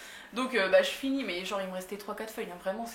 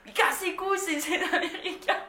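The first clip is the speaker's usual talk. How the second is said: higher, fed-up mock shout; half-hearted angry shout; grumbling yell; 8 semitones higher than usual. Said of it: "je gueule".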